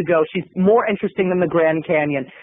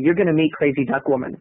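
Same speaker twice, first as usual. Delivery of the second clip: ordinary talk, nothing marked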